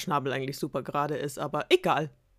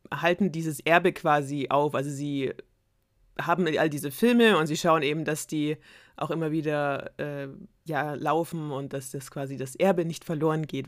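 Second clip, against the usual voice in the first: ordinary delivery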